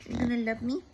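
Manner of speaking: put-on doting baby talk; baby talk to dog. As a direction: coo at the dog for love. Talking to her dog.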